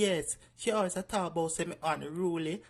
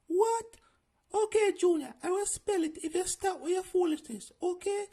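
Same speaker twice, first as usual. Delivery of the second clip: prim high schoolteacher, strict; high bossy teacher; 12 semitones higher, 4 dB louder than usual. Acted. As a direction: lift it high and stern like a teacher.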